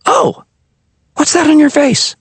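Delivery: put-on high eager boy voice; a kid blurting out curiosity; excited boy. A narrator's reading.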